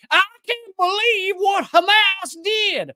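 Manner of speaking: very high, squeaky mock Israeli accent. Host mocking Israeli officials for viewers, in a squeaky mock Israeli accent. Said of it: "That's how they always talk".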